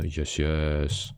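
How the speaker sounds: ordinary talk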